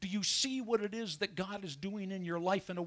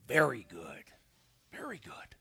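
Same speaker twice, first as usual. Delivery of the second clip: hushed awe; 7 semitones lower than usual. Retold or imitated. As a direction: breathe it out in reverent awe. God's verdict on creating man.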